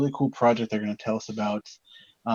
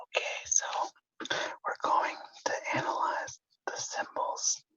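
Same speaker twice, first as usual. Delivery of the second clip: ASMR whisper; 6 dB quieter than usual. Pretend. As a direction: breathe it out like an ASMR video. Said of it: "it's like A S M R".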